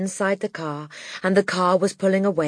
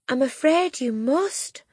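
gentle regret; 8 semitones higher, slower than usual. Acted. Miss Honey voiced.

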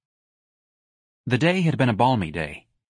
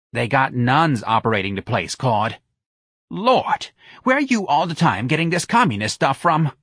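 brash, higher-pitched retort; exasperated Santa; 5 semitones higher, 3 dB louder than usual. Acted. Santa rebukes Claude over communist talk, exasperated. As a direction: take a foolish friend to task.

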